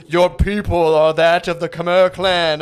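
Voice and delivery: loud, slow, booming voice from the heavens; Lion King sky voice. Retold or imitated. Mocking a dad addressing his child as the sky, booming grandiose voice. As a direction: proclaim it like a giant ghostly father in the clouds. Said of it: "sounds like the sky from the Lion King".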